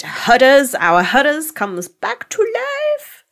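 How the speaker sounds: rising high and delighted, stretching it out; gushing fan excitement, high and slow; excited squeal. Real, not acted. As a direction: squeal with delight at his return. Excited about beloved character.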